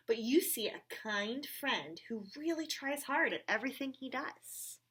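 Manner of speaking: lively read-aloud with character voices; reading to children. Acted. Reading a picture book aloud to children, animated. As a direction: read it brightly to the little ones.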